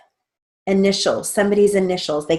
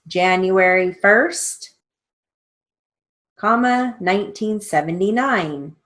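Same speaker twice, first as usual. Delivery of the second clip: dictating; 2 semitones higher, slower than usual. Shown as a demonstration. Dictating dates.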